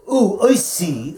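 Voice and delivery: ordinary talk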